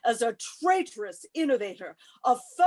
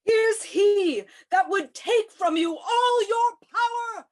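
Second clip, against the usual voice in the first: loud, higher rousing shout; 8 semitones higher, 4 dB louder than usual. Acted. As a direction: whip up the mob. Tribune rouses the mob.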